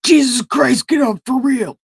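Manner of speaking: high, loud wake-up yell; exasperated mock yell. Disciples rousing sleeping Jesus, acted.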